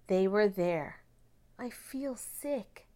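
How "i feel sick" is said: slow, much softer, faint voice; weakly. A narrator's reading. Sarah tells her father outside the school, weak and faint. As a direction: barely get the words out, queasy. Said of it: "said Sarah weakly".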